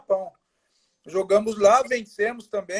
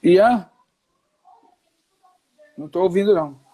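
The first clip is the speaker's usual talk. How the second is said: raised voice over a bad line; calling out, trying to be heard; to someone hard of hearing; 3 semitones higher, 6 dB louder than usual. Real, not acted. Call breaking up, can't hear.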